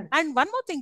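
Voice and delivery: normal talking voice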